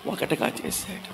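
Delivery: hushed whispered answer. Real, not acted. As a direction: breathe the answer almost silently. Answers own question to children.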